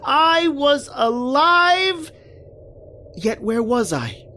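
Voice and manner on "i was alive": high, loud, drawn-out shout of joy; ecstatic slow cry. Acted. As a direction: stretch out the words in disbelieving joy. Joyful realisation he survived.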